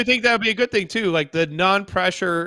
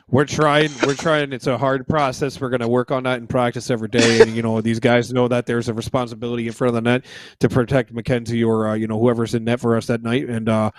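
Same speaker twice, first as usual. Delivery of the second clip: lower, tired coach answer; lightly voiced coach; 7 semitones lower than usual. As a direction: answer like a weary coach at the podium, low. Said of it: "I'll pretend to be Lindy Ruff".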